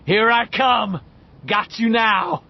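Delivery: loud, high threat; bully gloating as he climbs; menacing shout. A narrator's reading. Henry threatening the kids below, loud.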